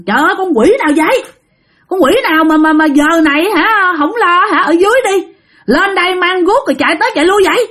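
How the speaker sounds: high-pitched mock yell, no real volume; retold angry scolding. Aunt scolding whoever clatters outside, retold high-pitched mock yell. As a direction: imitate an aunt calling out crossly. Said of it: "dì Năm mới la giọng ra".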